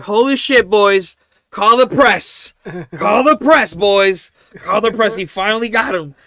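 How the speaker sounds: high loud teasing celebration; hyped gamer shout. Retold or imitated. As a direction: cheer your buddy like a hype man. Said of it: "And Tyler's like".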